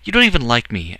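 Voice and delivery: put-on loud, high outburst from a hurt boy. A narrator's reading. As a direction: burst out in protest, loud and high. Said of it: "Edward burst out".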